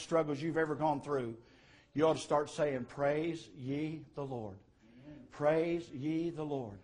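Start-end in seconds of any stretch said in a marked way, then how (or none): 2.8-6.8 softer, drawn-out words of praise; slow praise refrain. Acted. Preacher voicing praise at life's lowest ebb, slow and subdued. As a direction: say it slowly and quietly, stretching each word. Urges saying the refrain in struggle.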